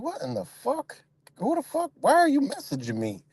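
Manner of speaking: high, baffled questioning without raising volume; retold disbelief, voice jumping way up; incredulous. Streamer retelling his answer to an unfriending message, incredulous.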